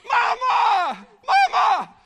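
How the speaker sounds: high, loud repeated calls; excited yells for mama. Acted. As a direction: shout for her again and again. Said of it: "can't you picture her busting through the door".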